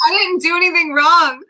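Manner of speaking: squeaky high, loud wounded whine; whiny counter voice. Pretend. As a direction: play the kitchen counter protesting innocence. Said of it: "Counter's like".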